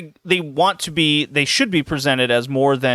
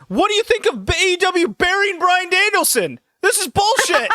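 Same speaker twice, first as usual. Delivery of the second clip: very high, squeaky outraged fan voice. Retold or imitated. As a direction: squeal it like a fan who can't believe it. Stocker shouts while speaker leaves.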